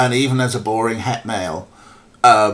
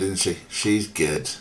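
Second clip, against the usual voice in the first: ordinary talk, nothing marked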